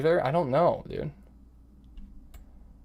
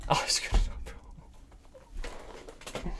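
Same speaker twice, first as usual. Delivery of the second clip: voiceless, soft despair; defeated whisper; 11 dB quieter than usual. Real, not acted. Chess player who blew the draw, defeated whisper. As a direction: let it fade to a whisper in defeat.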